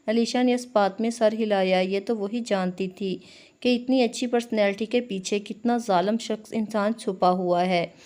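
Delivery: plain reading voice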